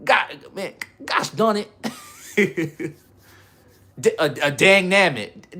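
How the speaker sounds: hammed-up minced oath; mock clean swearing. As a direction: play the squeaky-clean kid. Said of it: "that's how we gotta talk now".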